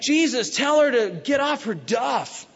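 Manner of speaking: high-pitched mock-frantic complaint; mad, upset Martha. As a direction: whine it up high, exasperated and drawn out. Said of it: "And she's mad. She's upset".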